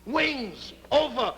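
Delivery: slow, booming film-title reading; grand title announcement. Retold or imitated. Comic reading out the film's title, slow and portentous. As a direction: draw out each word of the title grandly. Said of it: "on the bottom of the wings is the title".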